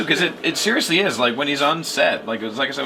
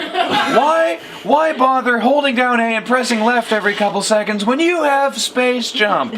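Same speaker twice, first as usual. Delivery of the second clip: hammed-up product pitch; Billy Mays pitch; 6 semitones higher than usual. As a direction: sell it like a shouting TV pitchman. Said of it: "Billy Mays here for Space Jump".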